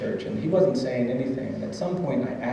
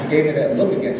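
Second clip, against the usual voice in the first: ordinary talk, nothing marked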